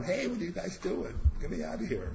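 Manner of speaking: high, urgent call up out of a pit; Joseph calling up. Retold or imitated. Joseph calling to his brothers from the pit, high and pleading. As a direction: play the trapped teen shouting for help.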